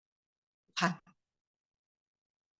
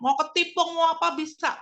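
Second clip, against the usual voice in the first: ordinary talk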